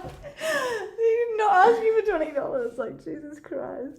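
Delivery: high, drawn-out incredulous outcry through laughter; scandalised friend, slow and high; retold disbelief. Retold shocked reaction to friend's date.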